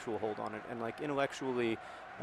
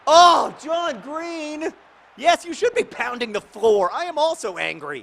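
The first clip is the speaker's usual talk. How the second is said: drawn-out, very loud, high yell; loud angry shout; 12 dB louder, slower than usual. Real, not acted. Gamer yelling at his player after a miss, loud angry shout. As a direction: bellow in pure frustration. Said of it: "Sorry, was that too loud".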